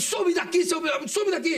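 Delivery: grito agudo pra sumir; manda sumir. Retold or imitated. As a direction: enxota o cara gritando. Expulsando o noia. (grito enxotando)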